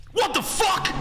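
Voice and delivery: high squealed joke outburst; mock shout. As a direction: shriek it for laughs. Joke escalation of daughter's catchphrase.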